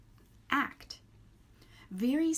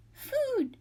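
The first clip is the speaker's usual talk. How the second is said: very high, loud little gosling cry; hatchling cry; 4 dB louder than usual. A narrator's reading. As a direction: squeak it out like a tiny hungry chick. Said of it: "was the only response".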